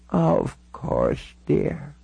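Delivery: put-on frail, drawn-out elderly voice; kindly old lady agreeing, unhurried; very old woman. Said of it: "She looked all of ninety-six years".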